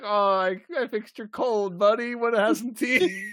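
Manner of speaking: high chirpy doctor, laughing through it; giggly imitation of a cheery doctor; mock Pulaski. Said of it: "Pulaski was like".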